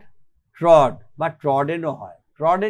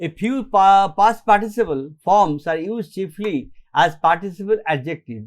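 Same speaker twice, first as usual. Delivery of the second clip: slow dictation; much slower than usual. Shown as a demonstration. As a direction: dictate the rule slowly for notes. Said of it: "slow dictation of rule".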